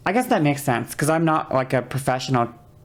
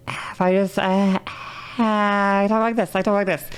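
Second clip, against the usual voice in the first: higher-resonance voice demo; 5 semitones higher than usual. Shown as a demonstration. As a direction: slide from a sustained high note into speech.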